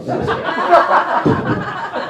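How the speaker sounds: breathy laugh at own joke; amused laugh. Real, not acted. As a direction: let out a laugh.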